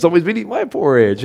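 high, fast Mama Bear complaint. Acted. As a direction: squeak it out like an upset mama bear. Mama Bear voiced in story.

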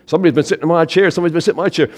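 loud, quick storybook bear voice; indignant bear. Acted. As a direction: rattle it off like an outraged bear.

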